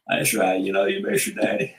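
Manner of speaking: deep, low cartoon voice; low character voice. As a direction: drop the voice way down into a character.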